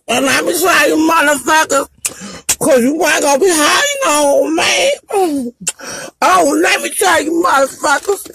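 real sobbing, shouting high through tears; crying rant. Real, not acted. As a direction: yell your hurt out through the crying. Breaks down crying while ranting online.

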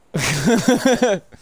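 loud cackling laugh; sudden explosive real laugh; loud burst of laughter. Real, not acted. Big laugh during racing game.

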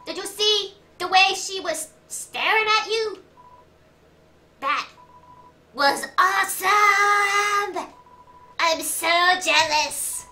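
loud, high, excitable little guy; made-up character voice. Acted. Little guy jealous of her staring, loud high voice. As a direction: shout it high, drag out awesome. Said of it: "I don't even know what this voice is".

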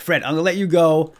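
usual speaking voice

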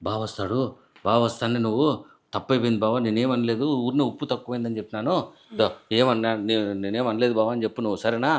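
low, soft conspiratorial coaching; hushed murmuring. Acted. Husband secretly coaches wife on apologising to his brother, low and hushed. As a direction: keep it down so nobody else hears. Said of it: "Gunna Gunna గుసగుస".

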